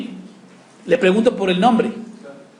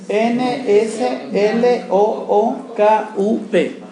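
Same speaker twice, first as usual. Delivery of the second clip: slow, raised letter-by-letter dictation; spelling out; 5 semitones higher, much slower than usual. Shown as a demonstration. Teacher spells a typed command for the class, slowly spelling out.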